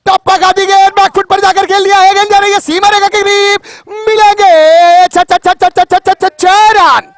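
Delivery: rising excited call of a six; live play-by-play. Real, not acted.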